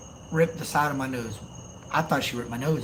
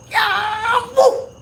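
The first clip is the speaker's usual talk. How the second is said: very high, loud imitated wail; hammed-up high yelp of pain; mock scream; 7 dB louder than usual. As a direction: shriek it out as if just smacked. Acting out reaction to being hit.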